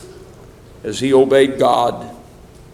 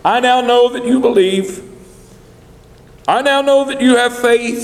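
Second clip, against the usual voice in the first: much higher, urgent angel's voice calling from above; angel crying out; 8 semitones higher than usual. Acted. God's angel calling to Abraham to stop, high and urgent. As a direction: call out to stop the knife, then proclaim. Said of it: "the voice of God's angel cried out".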